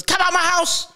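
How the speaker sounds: put-on high, yelled girlfriend kicking him out; mock angry girlfriend. Boyfriend imitating his angry girlfriend, high yelled. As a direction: shriek it like she's throwing you out. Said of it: "then she goes".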